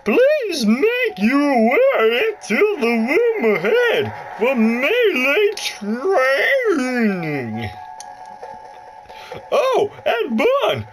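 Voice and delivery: high put-on voice of the lady game guide; announcer-like high lady voice, slow and bright. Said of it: "My voice just cracked".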